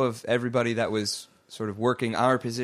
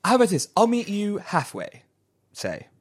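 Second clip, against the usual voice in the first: receptionist voice; 10 semitones higher, 4 dB louder than usual. Acted.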